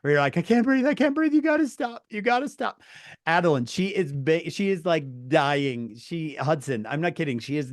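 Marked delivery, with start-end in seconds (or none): 0.4-2.8 high-pitched, helpless, gasping through laughter; laughing, can't breathe. Retold or imitated. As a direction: squeal it out while laughing too hard. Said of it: "laughing so hard you can't breathe".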